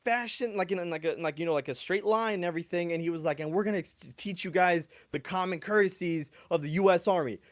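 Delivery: loud, high barking orders; drill sergeant orders. Retold or imitated. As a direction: shout commands at recruits.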